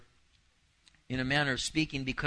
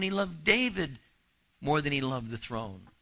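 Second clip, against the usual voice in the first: ordinary talk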